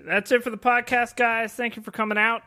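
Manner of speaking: mock sign-off. Host jokingly ends the podcast at once, mock sign-off. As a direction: pretend to wrap the show up early.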